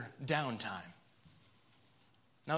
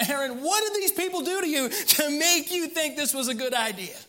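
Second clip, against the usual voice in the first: higher, louder, incredulous Moses; Moses confronting Aaron; 10 semitones higher, 5 dB louder than usual. Acted. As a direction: demand an answer in disbelief.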